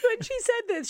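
normal talking voice

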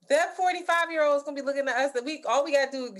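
excited. Real, not acted. Excited laughing overlap.

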